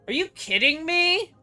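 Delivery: normal talking voice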